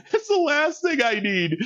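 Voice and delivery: said high through laughter; laughing. Real, not acted. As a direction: giggle through the line. Laughing at own clumsiness.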